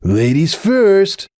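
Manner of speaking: slow, high, drawn-out taunt; mock gallant. A narrator's reading. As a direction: stretch it out with mock manners.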